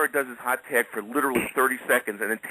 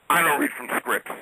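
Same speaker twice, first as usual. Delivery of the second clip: raised, firm put-on voice for Kowalski; quoting Kowalski; 5 semitones higher than usual. Kowalski answering the host who handed him a script, lightly imitated. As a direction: look down at him and refuse flatly. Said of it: "and he goes".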